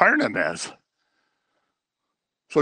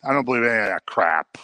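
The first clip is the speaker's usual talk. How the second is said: dismissive dying man; 5 semitones lower than usual. Retold or imitated. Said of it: "who would say".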